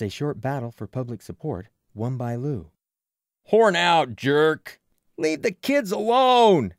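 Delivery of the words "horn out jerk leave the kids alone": high, loud crowd jeer; heckling shout. A narrator's reading. Townspeople heckling the man bothering kids, loud jeering. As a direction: yell him off the field, stretching the last words.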